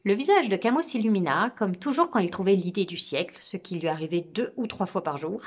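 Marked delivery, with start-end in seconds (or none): none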